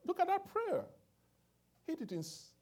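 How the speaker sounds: loud, high, rising preacher's cry; preaching at full force. Acted. Preacher pressing the point on the congregation, loud and climbing.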